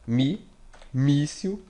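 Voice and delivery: mi then míssil with mí lifted; mí stressed, then the whole word míssil. Shown as a demonstration. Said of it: "je dois mettre míssil la plus forte". (míssil, insister sur mí)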